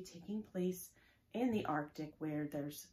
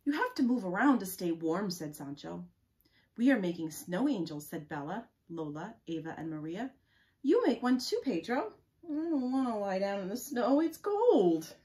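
story read-aloud; 10 dB louder than usual. Acted. Reading children's snow-angel chatter from a book, animated. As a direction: act out each child's line as you read.

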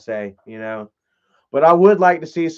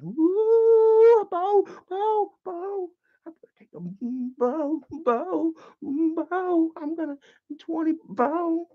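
high sneaky Tina voice; 12 semitones higher, slower than usual. Pretend. Tina coaxing Bo for a twenty, high and sly. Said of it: "She was like".

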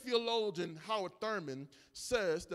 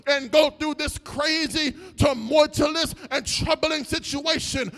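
louder, rhythmic preaching cries over the music; full-force preaching; 5 semitones higher, 10 dB louder than usual. Acted. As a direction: lift the church, call it out.

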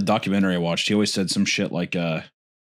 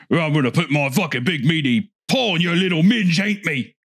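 higher, hammed-up British caricature; crude mock character; 4 semitones higher than usual. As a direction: play a leering caricature in broad accent. Crude Savile clip in spoof.

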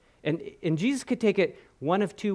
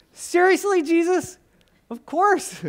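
mock incredulous crowd; 11 semitones higher, 3 dB louder, slower than usual.

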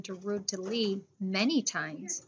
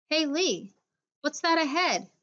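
calling out; 6 semitones higher than usual. A narrator's reading. Spotting something, calling to friend.

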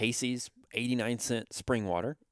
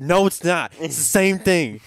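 arguing back; 6 semitones higher, 10 dB louder than usual. Retold or imitated. Arguing about the apple.

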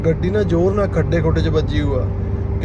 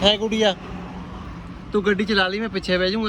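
higher pitch, rising playful questions; 4 semitones higher, 6 dB quieter than usual. Real, not acted. Driver jokingly asks a little girl to drive, child-directed voice.

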